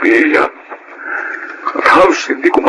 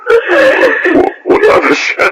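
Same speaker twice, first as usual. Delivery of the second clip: high, cracking with grief; breaking voice; much slower than usual. Acted. Father tells wife their baby is gone, voice breaking. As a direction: voice breaks on the news.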